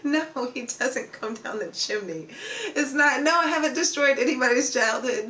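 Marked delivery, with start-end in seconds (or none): 2.7-5.3 laughing protest. Real, not acted. Denies ruining kids' Christmas, laughing.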